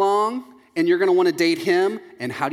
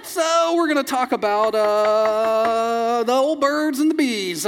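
high, strained, drawn-out awkwardness; hammed-up squirming, stretching the words and "uh"; mock uncomfortable; 6 semitones higher, 3 dB louder, much slower than usual. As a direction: stall awkwardly in a pitched-up voice. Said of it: "they get really uncomfortable".

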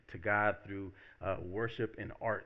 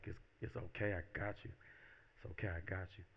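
gentle reassurance; 2 semitones lower, 10 dB quieter than usual. Acted. God answering a frightened new believer, gently reassuring. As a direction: soothe a scared person quietly.

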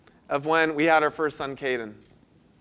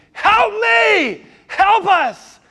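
screamed much higher and louder, pleading; desperate scream; 7 dB louder, much slower than usual. Retold or imitated. Father in the hospital hall calling for help for his son, a desperate scream. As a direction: yell down the hall for help with everything you have. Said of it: "I screamed".

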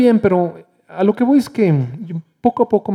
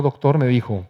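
ordinary talk

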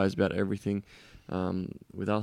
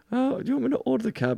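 much higher mock girlfriend voice; mimicking partner. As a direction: squeak it like a timid offer. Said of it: "And you're like".

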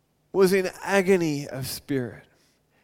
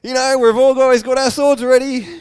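loud, high eager cry; itching for a fight; eager disciples; 7 semitones higher, 11 dB louder than usual. Retold or imitated. Disciple calling to Jesus, loud and eager. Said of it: "It's like".

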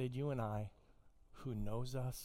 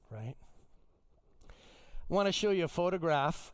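usual speaking voice

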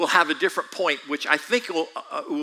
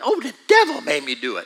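louder hammed-up excuse line; Flip Wilson excuse; 6 semitones higher, 6 dB louder than usual. Preacher mimicking the old Flip Wilson excuse, mock comic. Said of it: "Flip Wilson back in the seventies".

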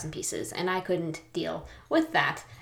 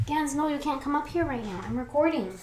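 high, raised voice to the dog; yelling across the room; 9 semitones higher than usual. Real, not acted. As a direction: shoo the dog away firmly. Shooing dog away from room.

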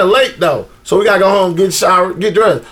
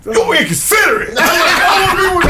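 mock scolding; 7 semitones higher, faster than usual. Scolding girlfriend in retold quote.

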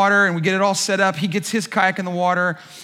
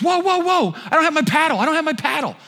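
high, frantic cries over the paddle; high panicked buddy; friend panicking; 8 semitones higher than usual. Retold or imitated. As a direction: yelp it like you just realized. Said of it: "and he goes".

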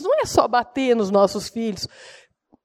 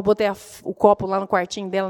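usual speaking voice

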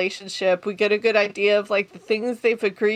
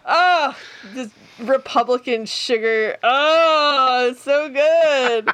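relishing; 4 semitones higher, 4 dB louder, slower than usual. Real, not acted. Savoring a favorite line.

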